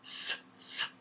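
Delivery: isolated hissed S, no voice; two short s hisses; letter sound demo. Shown as a demonstration. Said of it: "It makes the S sound".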